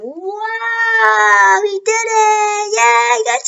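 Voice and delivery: high, singsong squeal of delight; bouncy, high, sung celebration; gleeful fanboy. Retold or imitated. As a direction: sing out your joy like a kid who met his hero. Deku thrilled by signed book.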